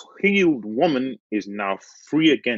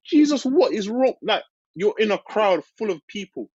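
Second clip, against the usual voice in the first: much higher, exasperated disciples' voice; high mock disciples; 11 semitones higher than usual. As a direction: squeak it out like baffled disciples. Preacher voices disciples in crowd.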